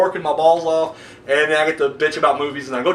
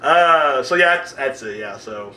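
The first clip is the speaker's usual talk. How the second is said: usual speaking voice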